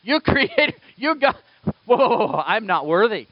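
high, loud, stammering protest; flustered Peter. Retold or imitated. Peter protesting to Jesus, high flustered panic. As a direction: backpedal in a panic, whoa whoa. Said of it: "I gotta believe it's like".

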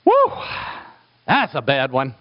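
servants wincing. Retold or imitated. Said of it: "the servants are going".

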